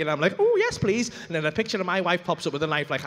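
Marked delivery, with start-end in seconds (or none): 0.4-1.1 high, keen little "ooh"; giddy mock-eager yes; eager acceptance. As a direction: jump at the offer, pitched high. Eagerly accepts the party invitation.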